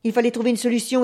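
ordinary delivery

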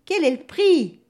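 loud, high, impatient demand; irritated. A narrator's reading. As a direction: snap the question out. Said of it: "s'énerve le diable".

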